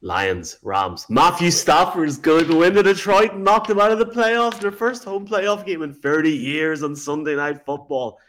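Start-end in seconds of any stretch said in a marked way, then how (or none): 1.1-8.2 high, loud hype rising then easing down; big-match hype, pitched up and pushed; excited commentator call. Pretend. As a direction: call it like a dramatic sports prediction. Mock call of an imagined game.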